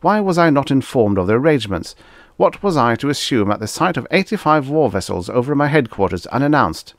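fast, high-pitched outrage; affronted boss demanding answers; indignant tirade. A narrator's reading. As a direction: berate the staff with rapid questions. Irate ambassador berates his staff.

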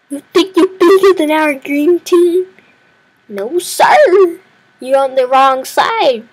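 hammed-up trash talk; cocky taunt. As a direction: swagger at the enemy. Taunting the rival team.

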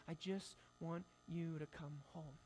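soft, slow, gentle longing; tender plea. Retold or imitated. Father quietly telling his son to come home, soft and slow.

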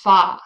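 "Fa" said loud, as an isolated strong syllable; stressed syllable alone. Shown as a demonstration. Teacher isolating the stressed syllable, loud separate "Fa". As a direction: land firmly on a lone "Fa". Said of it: "the stressed syllable".

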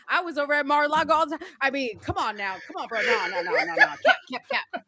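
high, loud, fast preachy rant; hammed-up Bryson ranting at the chat; Bryson impression. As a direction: play Bryson lecturing everyone about the Bible. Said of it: "this is Bryson".

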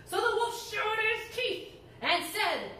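put-on lively read-aloud to children; animated storyteller voice; storybook reading. A narrator's reading. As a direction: tell it like a bedtime story to kids.

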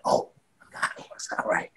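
whispered, voiceless aside to self; soft breath of retold amazement; hushed awe. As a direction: whisper it as if thinking aloud. Retold private awe at getting reply.

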